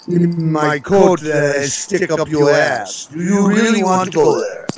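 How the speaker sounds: mock tough-guy threat; confrontational performer. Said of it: "someone coming out and".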